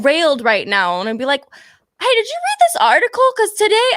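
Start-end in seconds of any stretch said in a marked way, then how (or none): 2.0-3.9 chirpy chatter. Retold or imitated. Acting out chatting during sex.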